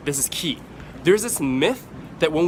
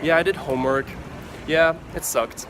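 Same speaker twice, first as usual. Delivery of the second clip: bored; 2 semitones lower, 3 dB quieter than usual. Shown as a demonstration. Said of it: "talk about it in a very boring way".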